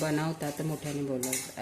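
ordinary talk

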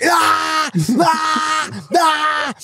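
hammed-up heaving noises over the toilet; imitated retching. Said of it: "I'm like".

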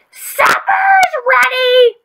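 high, slow, drawn-out shout calling everyone to eat; dinner call yelled high and long at full voice; top-of-voice shout. A narrator's reading. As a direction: bellow it across the room, stretching every syllable. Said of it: "she shouted at the top of her voice".

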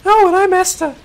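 put-on squeaky high young girl voice. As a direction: voice jumps way up like a girl. Voicing Esther, high pitch.

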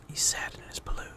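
hushed pitying whisper. Real, not acted. Gamer pitying the driver in the balloon, whisper. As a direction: breathe it without voice.

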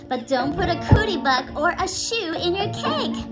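ordinary talk

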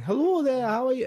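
high-pitched, shaky-footed Scottish accent; attempted Scottish impression. Shown as a demonstration. Showing co-host a Scottish impression that falls apart, mock Scottish accent. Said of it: "try and do a Scottish impression".

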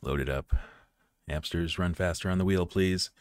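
low, soft muttering to a machine; soft, low mock plea; muttered plea. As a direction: coax it quietly under your breath. Muttered plea to slow console.

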